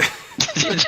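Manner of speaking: high unvoiced laugh burst; burst of laughter. Real, not acted. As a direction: let a squeaky laugh burst out. Laughing at the roll.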